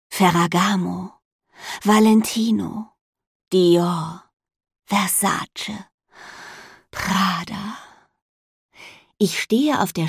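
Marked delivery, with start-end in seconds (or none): 0.1-7.8 savoring each brand name; awestruck. A narrator's reading. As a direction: breathe out each name in awe. Dreamy naming of designer brands.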